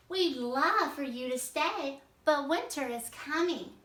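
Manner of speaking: kindly polar bear. Acted. Teacher voices Paulina reading drama.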